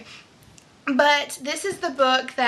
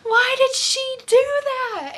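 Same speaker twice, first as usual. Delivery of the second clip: much higher outraged gasp of disbelief; shocked outcry; 13 semitones higher, much slower than usual. Retold or imitated. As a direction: cry out in shock at a character's choice. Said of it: "it has me going".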